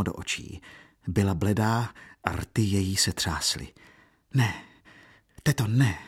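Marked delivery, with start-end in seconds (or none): none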